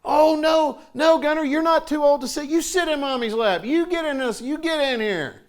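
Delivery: hammed-up mother calling her son back into her lap; clingy mom. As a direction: coax him back into the lap, high and insistent. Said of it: "what can she do".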